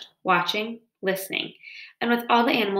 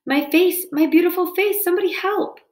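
high, loud howl for help; 10 semitones higher, 4 dB louder than usual. A narrator's reading. Wounded fox on a log calls for help, howling. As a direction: wail it high and loud, hurt and desperate. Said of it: "howling in".

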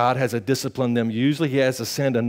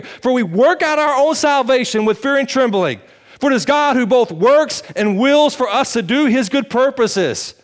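raised, fervent preaching voice; 9 semitones higher, 5 dB louder than usual. Acted. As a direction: preach it out with conviction. Preaching building again.

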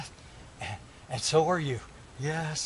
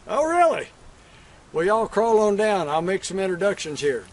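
louder, hearty hello to riders; calling out greeting; 2 semitones higher, 6 dB louder than usual. Acted. Cowboy hailing riders pulling up, loud greeting. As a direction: call out to them across the yard.

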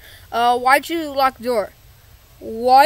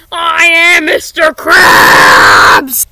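very slow, much louder wailing yell; anguished shout; 10 dB louder, much slower than usual. Acted. Mister Krabs howling his identity at the boys, anguished yell. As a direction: drag out every word as a desperate cry. Accused of being a robot.

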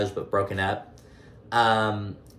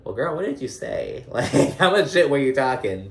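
higher, mock-accusing girlfriend tone, laughing midway; sassy teasing question; 5 semitones higher, 4 dB louder than usual. Podcaster grilling Nina about her gossip, sassy and amused. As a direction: call her out playfully with a laugh. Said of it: "But I'm like".

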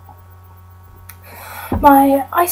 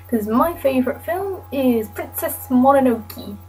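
normal talking voice

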